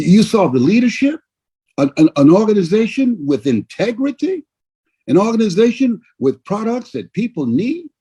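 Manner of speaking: ordinary talk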